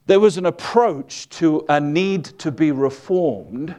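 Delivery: ordinary talk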